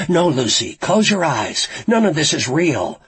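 high, loud urgent plea; urgent pleading. A narrator's reading. Frankie begs Lucy to ignore the taunts, pleading. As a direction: plead hard to break the spell.

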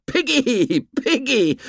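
very high-pitched mocking boy's cry; gleeful shriek. A narrator's reading. Ralph jumps up taunting the fat boy, gleeful high shriek. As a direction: jeer it in a high, squealing schoolboy voice. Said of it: "Ralph shrieked with laughter".

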